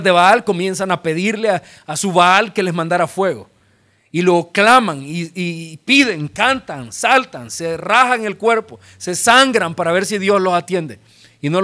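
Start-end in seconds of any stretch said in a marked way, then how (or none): none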